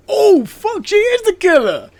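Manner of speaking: high, loud shriek of sudden realization; shocked shriek. Retold or imitated. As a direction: scream it like the twist just hit you. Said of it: "And I'm like".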